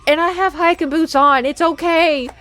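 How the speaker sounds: hammed-up exasperated reassurance; exasperated insistence. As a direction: throw up your hands in frustration. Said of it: "I'm like".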